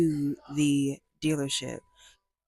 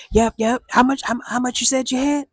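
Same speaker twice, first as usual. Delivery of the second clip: greedy dealer; 6 semitones higher, 4 dB louder, faster than usual. Retold or imitated. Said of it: "he was like".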